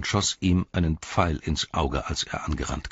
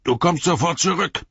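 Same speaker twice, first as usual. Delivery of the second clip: high-pitched orc soldier's yell; bellowed order; 14 semitones higher, 7 dB louder than usual. Acted. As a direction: yell after a deserter as an orc. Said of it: "brüllte der Soldat".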